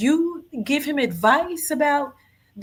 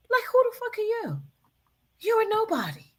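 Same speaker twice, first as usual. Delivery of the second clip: incredulous scoff; 11 semitones higher, 3 dB quieter, faster than usual. Real, not acted. Scoffing at girlfriend in clip.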